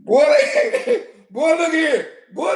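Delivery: normal talking voice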